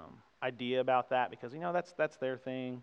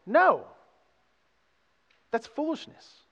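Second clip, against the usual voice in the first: sharp high loud refusal; emphatic no; 13 semitones higher, 9 dB louder, slower than usual. Real, not acted. Preacher rejecting the congregation's excuse, loud and emphatic. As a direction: shut the idea down hard. Rejecting sin to abound grace.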